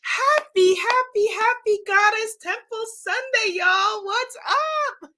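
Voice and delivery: high, bright sing-song hello; excited greeting. Real, not acted. Host greeting her Sunday viewers, high and sing-song. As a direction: lift the greeting way up, playful.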